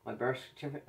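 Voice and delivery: talking around a pin in the mouth; words muffled by something held in the mouth; mouth full. Real, not acted. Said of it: "I have my D P pin in my mouth".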